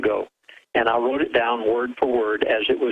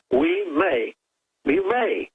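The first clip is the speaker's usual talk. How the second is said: "may" hit much higher and held; stress on may; 12 semitones higher, much slower than usual. Real, not acted. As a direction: lean hard on "may" as the warning word. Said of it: "watch out whenever they say may".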